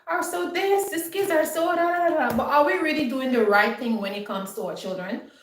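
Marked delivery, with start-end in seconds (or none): 0.1-2.4 high, sing-song mock lament; mock crying. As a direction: wail it like a complaining parent, hammed up. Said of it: "we crying and we saying".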